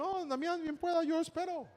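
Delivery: put-on high-pitched polite young customer; deferential boy. Boy letting others go first in the shop, deferential.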